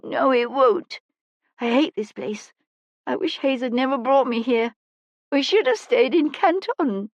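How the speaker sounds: put-on high-pitched, distraught child's outburst; crying child's despairing lament, pitched high; tearful. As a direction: pour out grief on the edge of sobbing. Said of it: "and started to cry".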